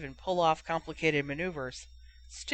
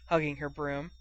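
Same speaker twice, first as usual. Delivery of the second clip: plain reading voice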